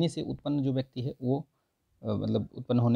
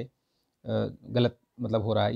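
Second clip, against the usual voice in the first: ordinary talk, nothing marked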